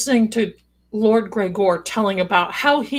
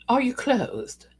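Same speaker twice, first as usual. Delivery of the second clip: slow, higher, mock-accented question; hammed-up foreign-lord accent; put-on accent for Lord Gregor; 4 semitones higher, slower than usual. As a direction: ask it slowly in a badly imitated accent. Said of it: "he doesn't have that accent. I can't do his accent".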